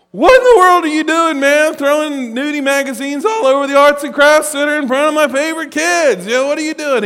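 high, loud exasperated yell; scolding a grown man for tossing nudie magazines; retold scolding. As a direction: bellow at him in disbelief. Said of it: "and I say".

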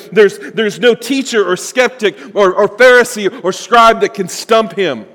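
fervent preacher declaring Jesus' unstoppable power; preaching at full force. Real, not acted. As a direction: build to a triumphant climax. Preaching climax.

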